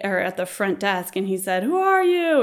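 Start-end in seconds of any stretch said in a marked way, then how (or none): 1.6-2.4 high-pitched mock demand; imitating a puzzled gatekeeper; startled challenge. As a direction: question a stranger, pitched way up. Desk guy challenging the yelling man.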